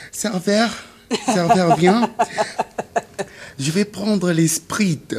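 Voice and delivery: high, shrill-free falsetto call to the waiter; mock woman ordering. Comedian mimics a woman calling the waiter. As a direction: play the lady summoning the waiter, high and drawn out.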